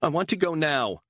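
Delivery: the same demand, now at breaking point; furious demand. Acted. Beatrice repeats demand, escalating.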